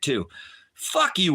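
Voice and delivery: usual speaking voice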